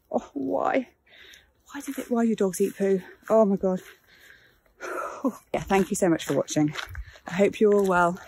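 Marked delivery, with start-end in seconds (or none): none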